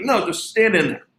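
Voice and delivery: high, breezy SoCal coach voice; imitating Ken Ravizza. Coach telling the player to just stand in, high SoCal voice. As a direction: wave off the question in a high SoCal voice. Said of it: "really high, SoCal voice".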